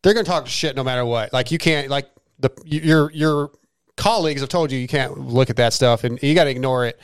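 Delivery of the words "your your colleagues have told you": ordinary delivery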